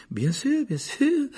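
high-voiced, distracted placating; absent-minded. A narrator's reading. Nurse taking the boy's pulse, absent-minded. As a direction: humour the patient absently in a high voice. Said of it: "d'un air absent".

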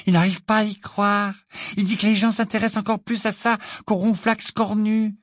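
high-pitched, eager character voice; thrilled, voice held up high; enthusiastic. A narrator's reading. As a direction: gush with wide-eyed wonder. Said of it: "les yeux exorbités d'enthousiasme".

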